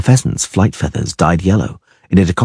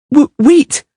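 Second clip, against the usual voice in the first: high, louder stammered call; much higher girl calling; panicked girl; 5 dB louder than usual. A narrator's reading. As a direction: stop them leaving. Girl calls them back.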